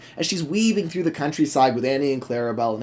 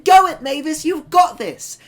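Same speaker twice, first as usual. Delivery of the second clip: much higher, cheering; enthusiastic Percy; 13 semitones higher, 4 dB louder than usual. Acted. Percy cheering Mavis from the loop, high and excited. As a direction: cheer her on at the top of the voice. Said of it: "he's just so enthusiastic".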